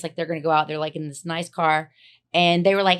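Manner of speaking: ordinary talk, nothing marked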